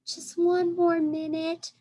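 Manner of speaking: high, slow pleading little elephant; pleading child. A narrator's reading. Baby elephant Ruby pleading with her aunt, high and slow. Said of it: "Ruby pleads".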